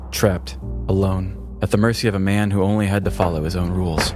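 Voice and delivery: plain reading voice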